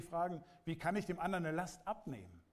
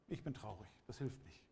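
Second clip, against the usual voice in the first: softer, lower mopey whine; mock self-pity; 5 semitones lower, 8 dB quieter than usual. Preacher mimicking a mourner hiding at home, mock-mopey. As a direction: sulk it out like someone hiding in bed. Mocking someone sulking alone.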